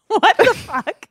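high and slow through real laughter; laughing disbelief. Real, not acted. Cohost cracking up at the intense label, laughing disbelief.

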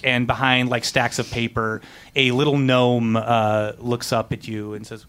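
ordinary talk, nothing marked

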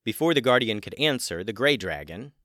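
ordinary delivery, nothing marked

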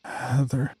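hoarse, quiet rasp; rasped name. Acted. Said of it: "I rasped".

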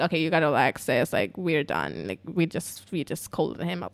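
low voice of a soothing cop; calming cop. Retold or imitated. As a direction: talk someone down, low and softening. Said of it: "And the cop's like".

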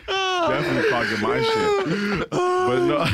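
high-pitched laughing yelp; cracking up while talking; laughing high outburst. Real, not acted.

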